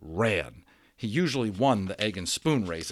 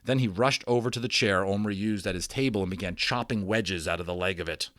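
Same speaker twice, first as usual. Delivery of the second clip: ordinary delivery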